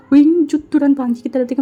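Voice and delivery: ordinary delivery, nothing marked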